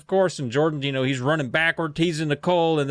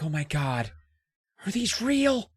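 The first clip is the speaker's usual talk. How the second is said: higher-pitched, mock-frightened questioning; fearful awe; 8 semitones higher than usual. Nicole sees giant footprints and fears a monster, mock-frightened wonder. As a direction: play a scared, gullible girl lightly. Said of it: "that in her fear".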